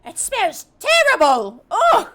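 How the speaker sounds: high, loud, disgusted goblin shriek. Acted. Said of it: "all of the goblins are going".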